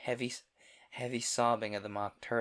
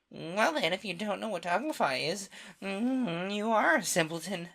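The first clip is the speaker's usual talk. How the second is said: scornful Gryphon; 9 semitones higher, 4 dB louder than usual. Acted.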